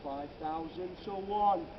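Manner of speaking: slow, measured statement of the odds; slow wonder. Acted. Guide to tour group, slow musing on the odds. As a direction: let the number sink in slowly. First take, slower and quieter.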